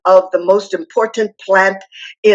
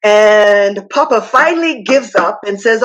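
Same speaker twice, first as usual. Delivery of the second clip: ordinary talk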